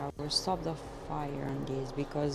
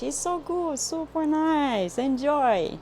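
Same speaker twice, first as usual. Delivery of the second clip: high, loud, gushing praise; squealing food praise; excited delight; 8 semitones higher, 6 dB louder than usual. Real, not acted. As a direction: rave about the food. Delighted about her lunch.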